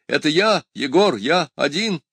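high, insistent call to be let in. Acted. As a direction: call your name through the door, urgently. Егор зовёт через запертую дверь. (настойчивый высокий окрик через дверь)

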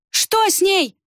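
high-pitched frightened question; frightened boy's urgent cry; panicked cry. A narrator's reading. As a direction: cry out in sudden dread. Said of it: "вскричал вмиг побелевший Берцх".

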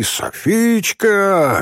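long drawn-out, high-pitched call; stretched shout at moderate volume. A narrator's reading. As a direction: holler the name as if from a treetop. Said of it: "заорал он с дерева".

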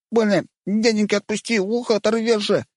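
put-on high wailing kid voice; wailing boy in pain. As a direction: wail as ear is twisted. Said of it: "голосил паренёк".

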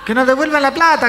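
very high, loud mock heckle; retold shout. Man demanding ticket money back, high mock shout. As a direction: yell it like an outraged heckler. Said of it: "gritó otro tipo".

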